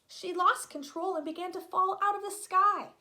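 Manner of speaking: ordinary delivery, nothing marked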